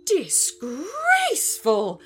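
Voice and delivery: high, loud, drawn-out outrage; affronted gorilla's loud cry; bellowing. A narrator's reading. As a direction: stretch the middle in a scandalised bellow. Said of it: "bellowed the gorilla".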